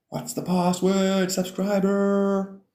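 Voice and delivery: hammed-up password guard, slow and high; mock gatekeeper. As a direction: drag it out like a doorman testing you.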